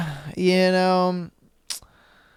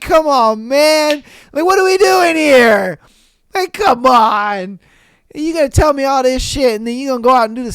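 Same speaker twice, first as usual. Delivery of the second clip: high-pitched, loud disbelief; outraged complaint, louder and higher; exasperated rant; 5 semitones higher, 3 dB louder than usual. Real, not acted. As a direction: vent at her, fed up. Ranting about cheating ex.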